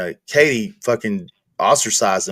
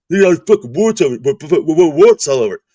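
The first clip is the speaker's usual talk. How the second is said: mock Gaker; 5 semitones higher, 5 dB louder than usual. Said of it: "He's like".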